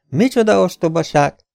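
high, loud indignant cry; indignant outburst. A narrator's reading. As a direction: scoff it out in outrage. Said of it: "háborgott Viktor".

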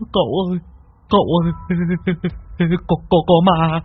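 high, stammering fear; frightened whimper. A narrator's reading. Disciple hiding behind Cậu warns of a ghost, frightened.

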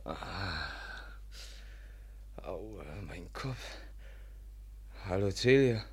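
dazed, soft and slow groan; groggy pain. Acted. Man waking up after a blow, groggy and hurting. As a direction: come to with an aching head.

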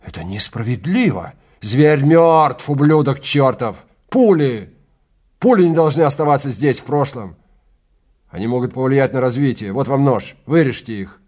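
high, loud angry tirade; furious outburst. Acted. Guide raging at Eckels over the bullets. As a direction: spit it at him in rage.

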